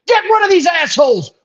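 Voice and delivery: high-pitched mock demand of an outraged mob; mock outrage. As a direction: imitate an indignant crowd calling for heads. Mocking outraged online crowd's demand.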